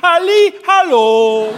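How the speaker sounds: high, loud sing-song hello; loud calling. Retold or imitated. Wife hollering into a bad line, loud. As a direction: holler into the phone.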